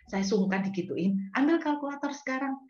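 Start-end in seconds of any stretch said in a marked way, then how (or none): 1.4-2.6 high, firm command; God commanding. Retold or imitated. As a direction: order it firmly from above. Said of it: "Tuhan bilang gitu". (perintah Tuhan dengan nada tinggi)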